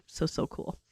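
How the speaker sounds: breathy voiceless whisper of praise. Real, not acted. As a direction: drop to a whisper as if sharing a secret. Soft heartfelt praise to viewers.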